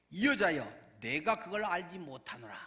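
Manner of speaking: loud, forceful retort as Peter; acted denial. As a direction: act out a firm denial, hitting the opening hard. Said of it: "베드로가 부인하여 이르되". (베드로를 흉내 내어 크게 부인하듯)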